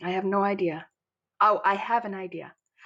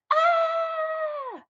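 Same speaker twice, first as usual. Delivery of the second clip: high, long frantic scream; panicked kid's scream; child scream; 3 dB louder than usual. A narrator's reading. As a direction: shriek in panic. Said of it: "screams my dad".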